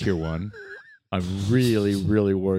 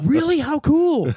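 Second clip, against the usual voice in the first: excited; 6 dB louder, slower than usual. Retold or imitated. Wife reacting to the news.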